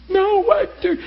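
very high, loud disbelieving cry; emotional outcry. Retold or imitated. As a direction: squeal it out, stunned and upset. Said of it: "he's trying to".

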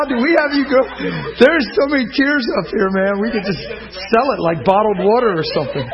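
high, loud exhortation with laughs; preacher laughing and calling out to a teary congregation; preaching at full force. Acted. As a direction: lift the room, ringing out over the crowd.

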